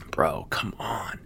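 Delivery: slow whispered exasperation; breathy aside. Real, not acted. Exasperated at the caller, slow under-breath whisper.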